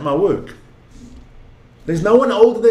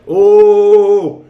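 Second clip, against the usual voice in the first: excited loud oh; 2 semitones higher, 8 dB louder than usual. Real, not acted. Excited gasp at a play.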